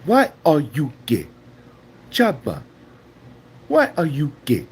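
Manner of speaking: mock African accent, emphatic and halting; hammed-up African accent, word by word; Chaba. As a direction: put on a punchy African accent. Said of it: "Chaba like".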